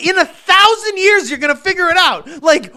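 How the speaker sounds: high, incredulous outburst; exasperated rant. Real, not acted. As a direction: pitch it way up in baffled outrage.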